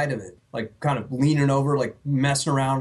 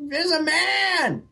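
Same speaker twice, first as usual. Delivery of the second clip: high, slow frightened cry; frightened squeal; slower than usual. Pretend. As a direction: wail it like a scared victim. Mock panicked report.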